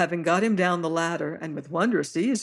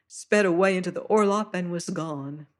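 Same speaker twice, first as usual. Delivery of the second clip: plain reading voice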